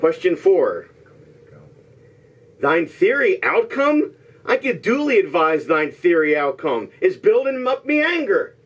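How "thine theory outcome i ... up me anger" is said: high, theatrical outburst climbing at the end; mock-Shakespearean indignation. Host reads disguised movie line, indignant mock-Shakespearean. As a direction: declaim it with rising pretend annoyance.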